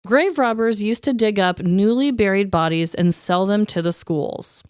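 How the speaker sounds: ordinary talk, nothing marked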